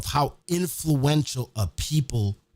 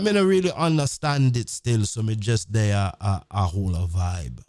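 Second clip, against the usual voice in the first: put-on laid-back Jamaican accent, sliding lower; Jamaican patois; 3 semitones lower than usual. Swiss dude explaining himself, in Jamaican patois. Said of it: "speaking patois back and forth like this dude is from Jamaica".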